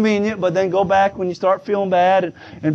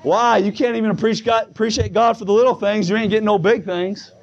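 ordinary talk, nothing marked

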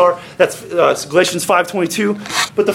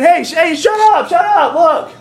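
high, exasperated mock yell; impatient scolding; 9 semitones higher than usual. Preacher voices Jesus hushing a spoiled child, impatient scolding. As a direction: cut in over the wailing, hammed-up.

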